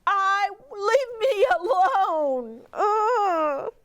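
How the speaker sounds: high, slow wailing cry of leave me alone; exasperated shout. Acted. Old woman shouting back at her family, a high wailing cry. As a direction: wail it out at the end of your rope. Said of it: "she shouted back".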